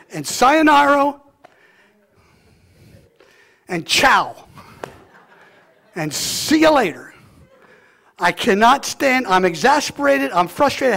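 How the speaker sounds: loud, high, fervent preacher climb; soaring loud pulpit cry; full-force preaching. Real, not acted. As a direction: preach it out to the whole church.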